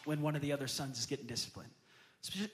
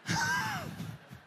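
high laugh. Real, not acted. Pastor laughing at his son's yell, high laugh.

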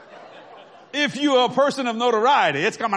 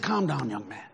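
soft, lower, soothing; calming; 10 semitones lower, 6 dB quieter than usual. Retold or imitated. Preacher calming the flustered young waiter, soft and soothing. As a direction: settle him down gently.

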